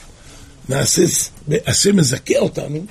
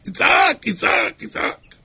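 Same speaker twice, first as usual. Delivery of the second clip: high drawn-out cry, fading away; 8 semitones higher, slower than usual. Shown as a demonstration. Preacher shows a man crying out for help, crying out. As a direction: cry the word out high, letting it die down. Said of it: "אדם יצעק".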